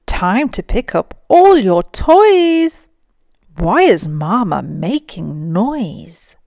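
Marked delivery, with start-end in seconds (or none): 0.1-2.9 high, loud call up the stairs; mother calling her little one to tidy up; calling upstairs. Acted. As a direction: call up to a child in another room. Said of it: "Mama llama calls upstairs".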